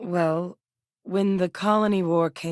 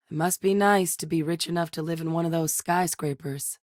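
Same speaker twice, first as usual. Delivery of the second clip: usual speaking voice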